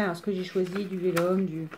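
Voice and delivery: ordinary talk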